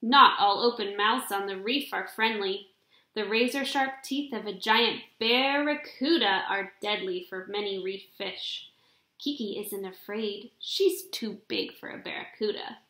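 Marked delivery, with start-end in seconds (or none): none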